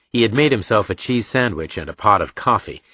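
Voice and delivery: plain reading voice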